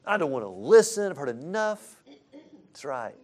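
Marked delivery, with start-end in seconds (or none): 0.0-2.0 put-on louder, higher defiant child; defiant kid. Pastor echoing a teen to the church, defiant and louder. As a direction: sulk like a teen who won't listen.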